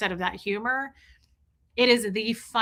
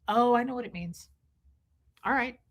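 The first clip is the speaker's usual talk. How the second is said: normal talking voice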